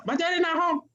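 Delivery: high-pitched mock child on the phone; child answering phone. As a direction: cover for daddy like a small child.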